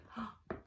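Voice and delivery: quick unvoiced intake of breath; sharp gasp. The creatures gasp at the brave mouse. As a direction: gasp in amazement.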